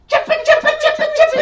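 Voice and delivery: very high, very loud chanted scream; piercing, repetitive kid's shriek; imitated child's screaming. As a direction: screech it like an overexcited kid. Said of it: "just screaming".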